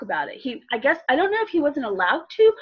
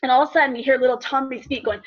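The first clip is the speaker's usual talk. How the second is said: ordinary talk, nothing marked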